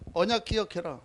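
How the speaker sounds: quietly, almost to himself: remember the covenant; hushed and low, let 언약 fall gently; soft reminder. Acted. Pastor to congregation, a soft low reminder.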